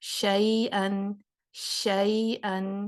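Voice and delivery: شین stretched very slowly with breath filling the mouth; spread shin. Shown as a demonstration. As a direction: let the air spread through the whole mouth on شین. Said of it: "اس پر آپ نے غور کرنا ہے". (شین کو سانس پھیلا کر لمبا ادا کرنا)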